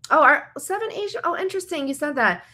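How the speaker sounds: mock surprised retort, pitched high. To someone mocking her fandom, a high mock-surprised comeback. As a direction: feign surprise high up, then turn it back on them.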